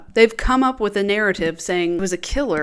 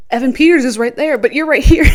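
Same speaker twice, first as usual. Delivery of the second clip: louder, higher, gushing at the screen; excited fangirl outburst; 5 semitones higher, 9 dB louder than usual. Pretend. Fan realises the actor is right there, excitedly louder. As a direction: squeal it like a fan spotting her crush.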